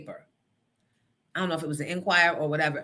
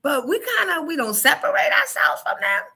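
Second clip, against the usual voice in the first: high, laughing mock voice; sing-song teasing, pitched way up; mock Sixers fan rant, high and giggly; 14 semitones higher than usual. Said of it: "I'm like".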